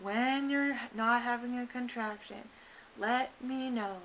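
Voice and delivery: lightly mimicked slow, drawn-out doctor; slow like a sloth. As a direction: stretch every word like a sloth. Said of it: "talking so slow. It was like a sloth".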